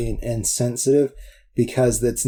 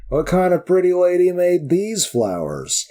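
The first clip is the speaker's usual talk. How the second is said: raised-pitch mock Western cowboy; Western accent; 6 semitones higher than usual. Cowboy mocking whoever made the flowers, imitated Western accent. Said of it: "Western accent is great".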